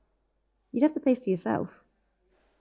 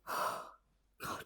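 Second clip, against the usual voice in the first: breathless sigh of relief after a scare; shaky relieved sigh; 15 dB quieter than usual. Acted. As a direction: let the fright out in a breath. Recovering from fright.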